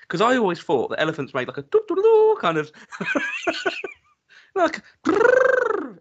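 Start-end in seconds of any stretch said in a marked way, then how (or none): 1.5-6.0 high hammed-up trumpet noise; trumpeting elephant. Shown as a demonstration. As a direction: blow it like a trumpet. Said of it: "I can't do it, like a trumpet sound".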